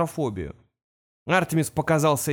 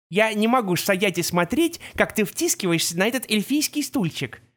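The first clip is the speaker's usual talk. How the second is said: lightly voiced fussy centaur; 5 semitones higher than usual. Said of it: "сказал тогда Жеребкинс".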